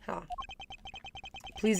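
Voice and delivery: ordinary talk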